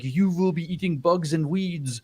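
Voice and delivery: hammed-up German-accented pronouncement; creepy German accent. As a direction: imitate a creepy German with a laugh underneath. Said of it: "in his creepy German accent".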